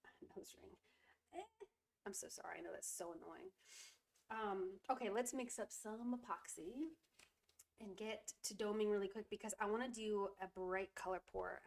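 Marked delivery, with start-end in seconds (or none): none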